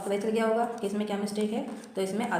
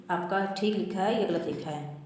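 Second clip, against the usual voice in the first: ordinary talk, nothing marked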